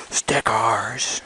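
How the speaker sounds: thrilled to find stickers; excited gasp. Real, not acted. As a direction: gasp then delighted hushed cry. Finding stickers in box.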